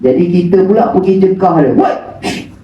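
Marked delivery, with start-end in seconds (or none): 1.8-2.5 mock angry yells at noisy kids; calling out to shoo running kids; scolding shout. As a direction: shoo the children off sharply, then hush them. Scolding children running in mosque.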